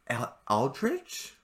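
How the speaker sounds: normal talking voice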